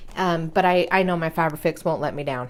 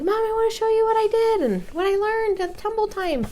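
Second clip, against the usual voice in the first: put-on high little-kid voice, much higher; little boy voice. Mom retelling her son asking to show his tumbling, high kid voice. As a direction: play her small son, eager and chirpy. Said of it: "but he's like".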